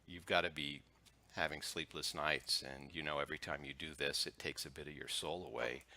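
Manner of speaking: softly, as if sharing a secret; a soft, low-volume plea to a caller; hushed confiding. Retold or imitated. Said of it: "And I'll say".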